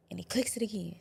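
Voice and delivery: breathy hushed whisper on clicks; hushed retelling. Retold or imitated. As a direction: let it drop to a whisper, tense. Retelling gun being clicked.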